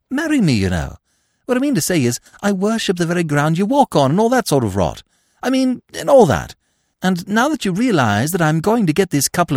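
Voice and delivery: high, breezy upper-class twit; chatty, airy young gentleman; silly young toff. A narrator's reading. Freddy proposes.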